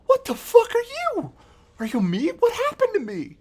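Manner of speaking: put-on high squeaky baby Oryx; tiny confused krill. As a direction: squeak it out like a bewildered tiny worm. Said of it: "and she looks up like".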